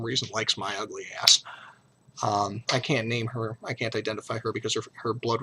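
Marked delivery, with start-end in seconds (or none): none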